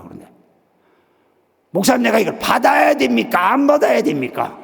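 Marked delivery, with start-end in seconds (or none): 1.7-4.6 loud, high-pitched insistent questioning; raised-voice aggrieved appeal; acted demanding. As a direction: demand an answer, imitating an exasperated caller. Caller demands an answer from pastor.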